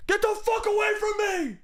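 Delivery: high-pitched screamed snapping outburst; hammed-up hangry shriek; angry scream. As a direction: scream it like a starving, furious boyfriend. Acted angry starving boyfriend screaming.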